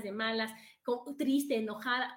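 ordinary delivery

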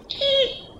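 very high thin squeal; harpy eagle screech. Retold or imitated. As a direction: shriek like an eagle. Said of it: "the harpy eagle was like".

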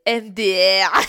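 shouted LOL, letter by letter; big jokey shout before cracking up; loud self-mocking outburst. Real, not acted. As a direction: blurt it out very loudly. Mocks own English accent, bursts out.

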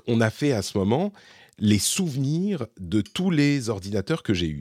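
ordinary talk

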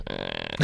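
very low, soft sleepy groan; low mock snore; imitated snoring noise. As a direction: mimic a deep sleeper's drone. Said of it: "making the same noises".

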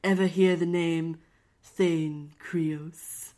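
sultry. Acted. Plays the lover, sultry.

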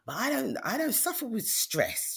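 high-pitched, stretched-out protest; protesting denial. Retold or imitated. Patient rejects doctor's stress diagnosis, high protesting denial.

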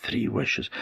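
a ghostly whispered echo of the fairy's words. Acted. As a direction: whisper Three wishes softly.

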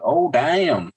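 high, swooping "oh, damn"; mock amazement. Reacting to Vader throwing Palpatine, mock amazement. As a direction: act out a stunned, impressed reaction.

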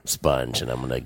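low husky seductive voice; sexy voice. Shown as a demonstration. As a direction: purr it low and sultry. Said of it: "say some sexy things".

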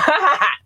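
short high cackle; teasing laugh. Real, not acted. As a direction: burst of playful high laughter.

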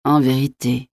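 subdued, sorrowful disbelief; pained, stunned murmur. A narrator's reading. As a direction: take it in painfully, almost to yourself. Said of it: "murmura La Mole avec une stupéfaction douloureuse". (murmure de stupéfaction douloureuse)

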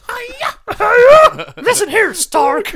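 high, loud diva pig shriek; Miss Piggy voice. Acted. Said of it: "Miss Piggy as Natasha Romanoff".

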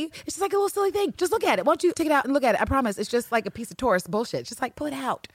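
high, coaxing mock plea; wheedling. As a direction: imitate someone sweet-talking with a flimsy excuse. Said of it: "here goes Constant on his bullshit".

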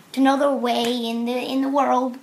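ordinary delivery

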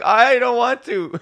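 hammed-up pleading whine; mock reluctance.

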